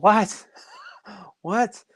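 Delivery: high, startled "What?"; a retold jolt of disbelief; surprised what. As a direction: caught off guard by a sudden offer. Told they want four prints.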